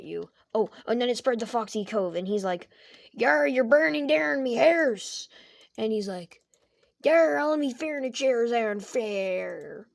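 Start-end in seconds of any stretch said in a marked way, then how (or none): 3.1-5.3 pirate voice. Acted. Foxy pirate burning. 7.0-9.9 pirate voice. Acted. Foxy pirate furniture burning.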